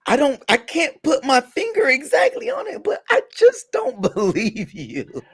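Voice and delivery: high, slow, laughing disbelief; mock doubter. Friend mocking how people see [name], high and laughing. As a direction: put on a squeaky doubting voice. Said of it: "they look at him like".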